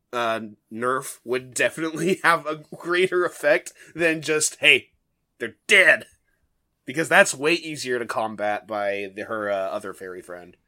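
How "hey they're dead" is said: loud blunt announcement. Pretend. Curse quote, louder.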